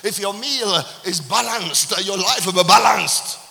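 preacher building to a loud call; preaching climax. Acted.

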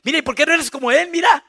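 loud, high mock wife; comparing wife. Wife comparing her husband to the pastor, high and loud. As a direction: needle him in public. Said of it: "no compare a su esposa en público".

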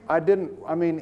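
ordinary delivery

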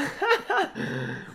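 high burst of laughter. Real, not acted. Laughs after accent remark.

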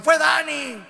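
high, loud blaming shout; a Philistine yelling the blame, high and loud; shouted accusation. Acted. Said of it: "Y algunos gritaron".

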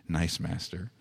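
fading to a soft, wheedling murmur; softly. A narrator's reading. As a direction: let nice master sink almost to nothing. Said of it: "said Gollum softly".

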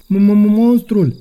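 high, loud stuttering fright; startled stammer. A narrator's reading. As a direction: stammer it out in a sudden scare. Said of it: "tresări Ronț".

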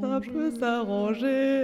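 drawn out, slow echoing voice; slow reverb echo. Pretend. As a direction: stretch it out under heavy reverb. Repeating line slowly with reverb.

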